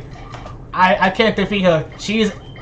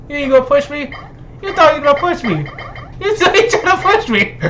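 higher, building cocky taunt; rising mock taunt; 7 semitones higher than usual. Taunting a player who can't push him. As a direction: get higher and cockier each time.

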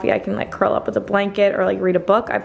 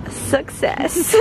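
whispered triumph; 6 semitones higher than usual. Real, not acted.

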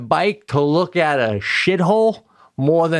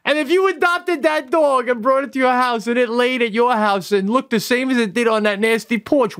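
ordinary delivery

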